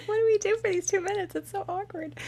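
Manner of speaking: high, fast, fretful voice; hammed-up awkward wedding guest. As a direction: play a flustered guest, high and quick. Joking about wedding conch.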